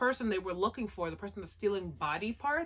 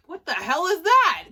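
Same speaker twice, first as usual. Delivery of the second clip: startled yell; 8 semitones higher, 11 dB louder than usual. Retold or imitated. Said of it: "she's just like".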